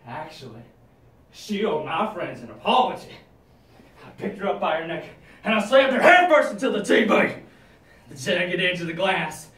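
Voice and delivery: normal talking voice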